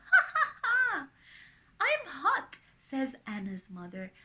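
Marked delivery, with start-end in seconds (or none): 0.1-1.1 put-on child's ringing ha-ha; high loud acted laugh. A narrator's reading. Anna heard laughing by mother, loud laugh. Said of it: "hear Anna laughing". 1.8-2.6 high, loud declaration; eager exclamation. A narrator's reading. Anna's mother hearing the laughter, eager and loud. Mother decides to join the fun.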